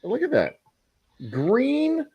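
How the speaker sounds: high, disgusted groan at the green drink. Real, not acted. To a podcast guest about absinthe, disgusted. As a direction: recoil at the sight of it.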